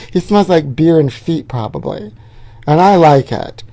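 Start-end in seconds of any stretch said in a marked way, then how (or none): none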